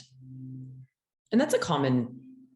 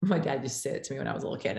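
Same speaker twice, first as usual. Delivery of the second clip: normal talking voice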